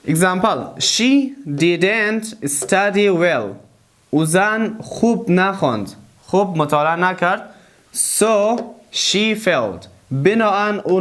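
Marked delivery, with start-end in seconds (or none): 0.1-3.5 very slow, word by word; slow dictation. Shown as a demonstration. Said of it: "teacher models slow English". 8.2-9.9 very slow, word by word; slow dictation. Shown as a demonstration. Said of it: "teacher models slow English".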